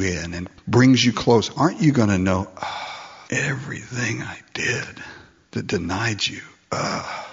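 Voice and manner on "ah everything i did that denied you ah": soft unvoiced confession; broken whisper. Believer facing Jesus, whispering a broken confession.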